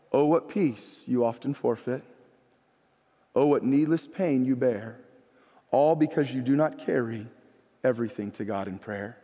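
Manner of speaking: low, measured, softening at the ends; solemn hymn recitation. Real, not acted. Pastor turning hymn on his listeners, solemn recitation. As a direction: bring it down low and let each line settle.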